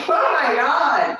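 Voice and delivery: high, loud, drawn-out exclamation; big stretched-out appalled shout; exasperated cry. Retold or imitated. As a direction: wail it slowly in disbelief. Acting exasperated reaction to the potatoes.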